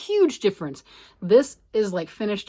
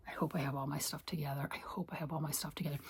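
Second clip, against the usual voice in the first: whisper; 5 semitones lower, 15 dB quieter, faster than usual. Real, not acted. Worried aside to herself.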